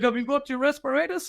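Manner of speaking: lightly hammed-up commander asking about respirators; mock commander. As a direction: pitch it way up, imitating the boss. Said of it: "he's like".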